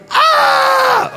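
high, loud pretend scream; mock scream. Shown as a demonstration. Preacher showing the kids how to react, mock terror scream. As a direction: scream as if a giant is coming. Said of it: "And you guys go".

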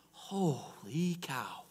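very slow, softened exclamation; awed. Real, not acted. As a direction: let it out slowly in amazement. Awed reaction after the reading.

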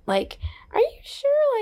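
breathy high skeptical question; doubtful younger self. Retold or imitated. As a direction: whisper the doubt like your unsure younger self. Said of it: "I'd be like".

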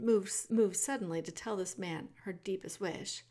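usual speaking voice

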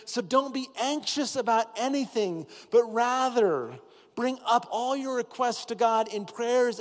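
high, loud, building pulpit cry; preaching at full force. Acted. Pastor urges the church to pray, not worry, preaching at full force. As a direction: preach it out to the whole room.

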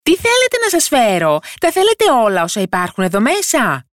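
high-pitched little yell; tiny character's piercing call; shouting, feigning incomprehension. A narrator's reading. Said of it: "έκανε ότι δεν καταλάβαινε και φώναζε".

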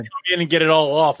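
usual speaking voice

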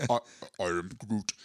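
low mock Groot line. Shown as a demonstration. Host tries to find the voice, low Groot line. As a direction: drop low like a tree creature warming up. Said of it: "how do you".

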